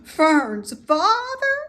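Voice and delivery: high, loud, slow gasping question; horrified disbelief. A narrator's reading. Wilbur horrified at the sheep's news, high and slow. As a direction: stretch it out in horror.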